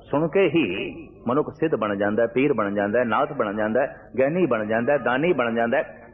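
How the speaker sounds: usual speaking voice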